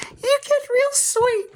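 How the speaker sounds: mock the aunt's fake sweet little-girl act. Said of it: "And you talk like this, like a little girl".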